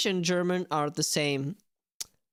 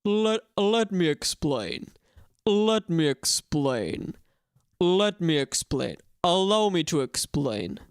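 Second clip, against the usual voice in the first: louder, slow character read; low pitched English; 3 dB louder, slower than usual. Shown as a demonstration. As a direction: drag out each word in a put-on voice. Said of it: "trying to low pitch my English".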